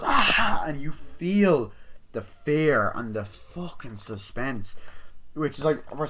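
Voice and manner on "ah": high, loud hammed-up shriek; retold scream, played big; mock scream. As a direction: let out a startled horror-movie scream. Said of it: "and she goes".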